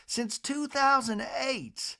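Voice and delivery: high-pitched society matron impression; Miss Patricia. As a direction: imitate a lady gossiping in a high, lilting voice. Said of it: "Miss Patricia in a talking head says".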